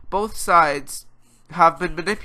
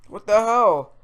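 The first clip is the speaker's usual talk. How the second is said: louder, higher exclamation; raised-voice disbelief; exasperated outburst; 5 semitones higher, 5 dB louder, slower than usual. Real, not acted. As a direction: burst out after a pause, exasperated. Exasperated at the scandal.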